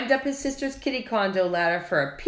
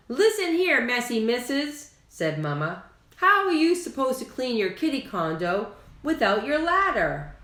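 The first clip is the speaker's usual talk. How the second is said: scolding mama; 6 semitones higher than usual. A narrator's reading. Mama scolds her daughters about the ladder, higher.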